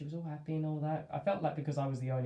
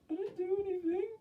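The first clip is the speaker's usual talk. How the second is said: very high, squeaky guilty-dog voice; innocent dog voice. Retold or imitated. Owner voices his guilty dog, squeaky and innocent. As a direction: play the dog pleading innocence.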